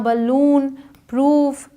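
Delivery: बलून and प्रूफ with a long "oo"; drawn-out vowel. Shown as a demonstration. Teacher shows long double-O to learners, बलून and प्रूफ drawn out. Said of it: "खींच कर बोलते हैं".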